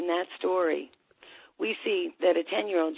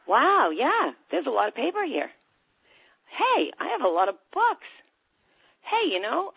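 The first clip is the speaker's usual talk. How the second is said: high-pitched, lilting discovery; mock realization; 6 semitones higher, 4 dB louder than usual. Imagined listener looking round a cluttered room, mock realization. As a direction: act out suddenly noticing the obvious.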